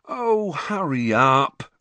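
drawn-out, loud impatient groan; moaning. A narrator's reading. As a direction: whine slowly with hungry impatience. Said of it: "Ron moaned".

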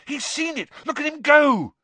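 high cry rising to a loud cheer; excited shout. A narrator's reading. Harry cheering the diving seeker on, shouting. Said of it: "Harry shouted".

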